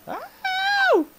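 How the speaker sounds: high, loud yelp of "ow"; pained yelp. Real, not acted. Gamer hit in the spider fight, high yelp. Hit while playing the game.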